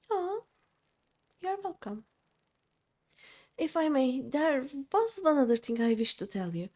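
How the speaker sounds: gentle grateful captive; timid freed girl. Acted. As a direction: thank them politely and nervously. Reads the freed girl's lines aloud.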